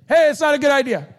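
breezy mock warning; casual retelling. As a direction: toss it out like a laid-back buddy. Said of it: "That's the Jake International version".

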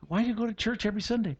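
a nosy coworker quizzing you quickly, pitched high. Retold or imitated. Coworker quizzing a churchgoer, high and quick. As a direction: play the curious outsider, high and quick. Imagined questioner at work.

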